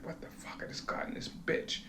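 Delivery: breathy whisper, barely voiced; whispered thought. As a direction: whisper it to yourself, fed up. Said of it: "I'm thinking".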